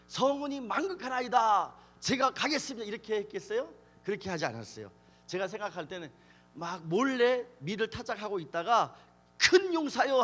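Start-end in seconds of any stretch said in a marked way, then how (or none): 0.1-2.9 higher-pitched, mock-reverent royal subject; mock courtier. Mock courtier answer to angel. 9.4-10.2 high, loud angel's call; sudden shout. Acted. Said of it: "소리를 지르면".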